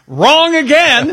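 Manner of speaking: high, slow, drawn-out game show buzzer call; catchphrase voice. Acted. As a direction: sing it out like a host ruling you wrong. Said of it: "I'm gonna keep saying it".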